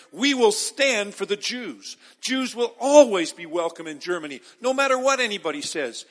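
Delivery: loud, ringing pulpit declaration; full-force preaching. Acted. Pastor quoting Merkel to the congregation, loud preaching. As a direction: proclaim it to the whole hall.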